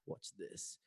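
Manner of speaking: hushed, whispered mock-God boast; God's playful aside. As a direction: whisper it, about to show off. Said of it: "and God said".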